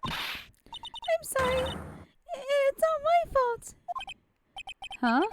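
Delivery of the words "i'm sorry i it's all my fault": put-on much higher, slow, remorseful child voice; high little girl. Pearl confessing to Nick, high and guilty. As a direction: let the tiny voice apologize.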